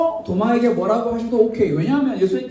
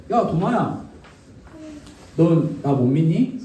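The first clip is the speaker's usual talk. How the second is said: low, slow reproach, mildly acted; reproachful; 5 semitones lower, slower than usual. Jesus confronting doubting Thomas, mild reproach. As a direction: put the question as a measured accusation. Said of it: "비난의 화살을 날릴 수가".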